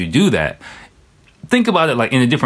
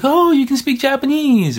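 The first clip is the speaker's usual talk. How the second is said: much higher mock-surprised falsetto; surprised Japanese staff. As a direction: imitate an amazed Japanese waiter, way up high.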